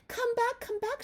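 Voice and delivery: high, loud calling lamb; high pleading call; lamb crying out. Acted. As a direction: call after the butterfly like a little lamb. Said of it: "she cried".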